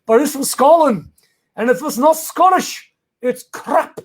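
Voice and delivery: mock Scottish accent, lifted in pitch. Shown as a demonstration. Host greeting a viewer from Scotland, in a put-on Scottish accent. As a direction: put on your best amateur Scottish accent. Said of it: "That's my best Scottish accent".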